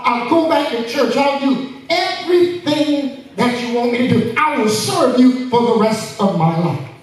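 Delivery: high, frantic pleading. Acted. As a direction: beg God for rescue in a rush. Jack pleading desperately with God.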